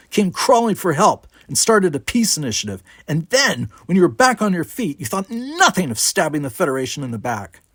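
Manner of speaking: heated, high-pitched tirade; angry accusation. A narrator's reading. Brickar accuses Worf's people of betrayal, angrily.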